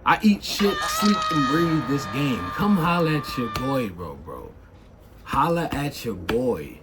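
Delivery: ordinary talk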